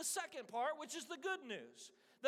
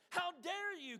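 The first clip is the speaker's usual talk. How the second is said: a mock shout of outrage, higher; 6 semitones higher than usual. King Canute rebuking the waves, mock shout. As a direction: rebuke the sea, indignant. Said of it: "Canute shouted".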